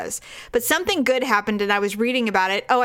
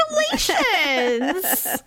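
laughing congratulations; 6 semitones higher, slower than usual. Real, not acted. Mock praise for saving fifty cents.

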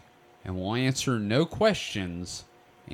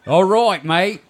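raised, loud mock Aussie; bad Australian accent; 5 semitones higher, 8 dB louder than usual. Podcasters trying to out-Aussie each other, bad loud Australian accent. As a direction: answer in a hammed-up Australian accent. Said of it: "y'all both suck at Australian accents".

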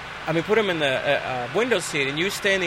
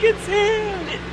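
panicked shout. Retold or imitated. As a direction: shriek out the culprit in panic. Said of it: "We're like".

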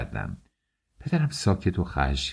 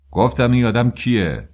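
higher, louder angry shout; father's angry demand; 6 semitones higher, 6 dB louder than usual. A narrator's reading. Father shouting at mother for the suitor's name. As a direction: yell the question at your wife.

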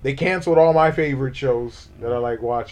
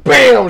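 a loud shouted bam, higher, like a gunshot; mock shotgun bam; 8 semitones higher, 10 dB louder than usual. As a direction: bark out the gunshot noise. Imitating a shotgun blast.

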